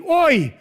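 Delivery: startled oh yelp; mock cry of oh. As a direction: yelp it out like a shocked listener. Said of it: "Хтось кричить".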